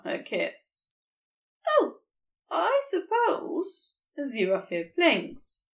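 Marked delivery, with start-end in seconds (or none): 1.7-4.0 high startled oh, then slow; surprised. A narrator's reading. Angel answers Crowley, startled then slow. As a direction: caught off guard, then considering.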